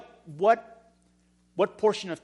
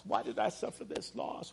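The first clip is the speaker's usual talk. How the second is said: breathy whisper, much softer; whispered grief; 4 semitones higher, 10 dB quieter than usual. Retold or imitated. Grieving person repeating the question to God in a whisper. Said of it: "maybe rocking back and forth, saying".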